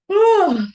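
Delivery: loud, high yawning "oh"; yawning sound. Shown as a demonstration. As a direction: let out a big open yawn. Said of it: "making sound on a big yawn".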